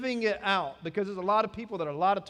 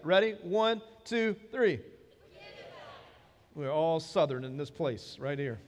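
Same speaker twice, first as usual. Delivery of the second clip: ordinary delivery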